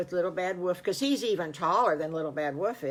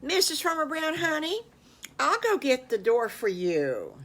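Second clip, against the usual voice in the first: higher, sweet husband voice; Farmer Brown; 8 semitones higher, 3 dB louder than usual. A narrator's reading. Husband sweetly addressing his wife, higher pitched.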